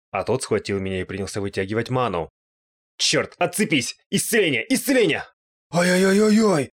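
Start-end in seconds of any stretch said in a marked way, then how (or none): 3.0-5.2 high cries, rising in pitch; frantic spell-casting. A narrator's reading. Отбивается от призрака заклинанием. 5.7-6.7 loud, high run of "oy"; yelp of pain. A narrator's reading. Призрака жжёт исцеление.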